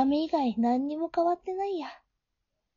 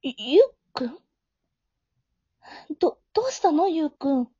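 shocked stammer; 4 dB louder than usual. Acted.

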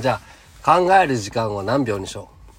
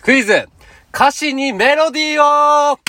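title call; 7 dB louder, slower than usual. Acted. Announcing game title call.

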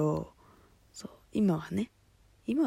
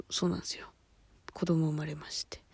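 whisper; 3 semitones lower, 5 dB quieter, faster than usual. Real, not acted. Announcing her friend's baby, whispered aside. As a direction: repeat the news in a soft unvoiced whisper. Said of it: "そう、子供が生まれまして。".

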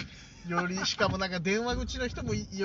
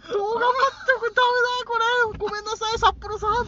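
stifled giggles; 7 dB louder than usual. Retold or imitated. Holding back laughter in retelling.

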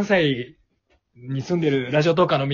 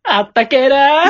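high, loud, drawn-out holler; badly acted catchphrase yell; clumsy hammed-up shout; 10 semitones higher, 9 dB louder, slower than usual. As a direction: belt it out slowly, overdone. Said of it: "もっと感情込めて言え".

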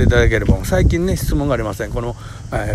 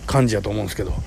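usual speaking voice

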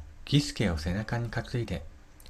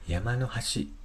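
ordinary delivery

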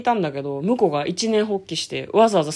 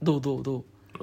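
extremely deep bass voice; 7 semitones lower, 9 dB quieter, faster than usual. Shown as a demonstration. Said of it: "これが一番低い。".

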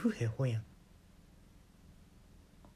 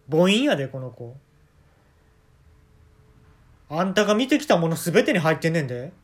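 renewed anger; 5 dB louder than usual. Acted. Renewed scolding about the dog.

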